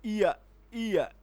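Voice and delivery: slow, drawn-out इया इया to show the y sound; slow "ya" glide. Shown as a demonstration. Teacher shows the linking y sound, slow glide. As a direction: stretch इया like a gliding "ee-ya". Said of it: "जैसे".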